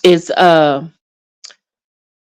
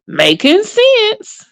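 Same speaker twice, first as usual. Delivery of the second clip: high, slow, knowing; high drawn-out ooh; mock impressed; 10 semitones higher, much slower than usual. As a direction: savor it like a good point landed. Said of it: "I was like".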